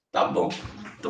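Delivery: breathy high laugh through the words. Real, not acted. Teacher laughing at a student's kind remark, giggling. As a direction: laugh it off, flattered.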